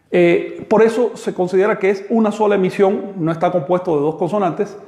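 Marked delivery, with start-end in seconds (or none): none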